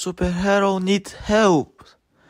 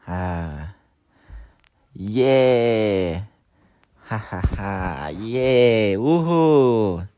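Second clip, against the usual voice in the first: deeper cheering toy voice; low figure voice; 8 semitones lower, slower than usual. Pretend.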